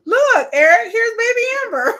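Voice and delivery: high, loud squeal breaking into laughter; gleeful announcement. Acted. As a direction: show off the baby in a squeaky mock coo. Gleefully acting out baby presentation.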